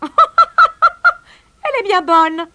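high, loud cackle into the words; mocking kingfisher laugh, high-pitched; sniggering. A narrator's reading. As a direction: laugh hard, then mock it slowly. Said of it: "ricana le Martin Pêcheur".

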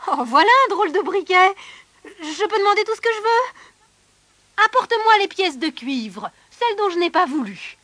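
loud, high and eager, marvelling at the tinderbox; giddy with sudden luck; delighted soldier. Acted. Soldier excited by briquet. (soldat ravi, fort et aigu)

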